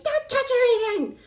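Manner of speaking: very high, loud squealing command; mock frantic lady, high and loud; panicked order. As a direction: shriek at her not to touch anything.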